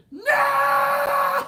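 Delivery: high, loud cracking scream. Retold or imitated. Terrified child on the Jaws ride screaming at the sister. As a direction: shriek it like a terrified kid.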